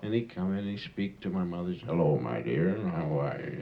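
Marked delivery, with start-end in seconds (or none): 1.9-3.5 put-on drunk father's greeting.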